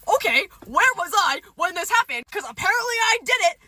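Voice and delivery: high, indignant retort; pissed off. Retold or imitated. Defending herself to friends over the rumor, pissed-off high retort. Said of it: "like, pissed off".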